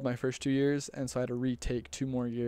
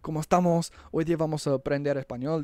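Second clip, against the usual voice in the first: high, loud classroom greeting in Spanish; imitating Spanish instructor; 5 semitones higher, 4 dB louder than usual. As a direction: rattle off a brisk Spanish welcome, speeding up at the end. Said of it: "just starts speaking Spanish to us".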